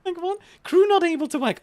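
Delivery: high, loud outraged disbelief; loud indignant what; shocked disbelief. Real, not acted. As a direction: react like the game just betrayed you. Shocked at game event.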